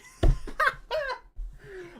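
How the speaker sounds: loud, high helpless laugh; real laughter breaking out; burst of laughter. Real, not acted. Streamer cracks up at own accent.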